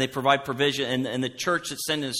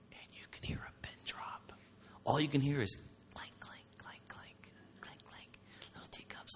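barely-breathed hush, teacups clinking; whispered imitation of a silent restaurant; 18 dB quieter than usual.

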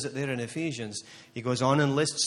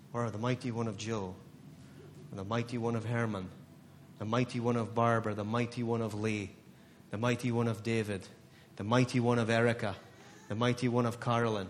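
quiet; 6 semitones lower, 6 dB quieter than usual. Real, not acted. Naming each listener softly.

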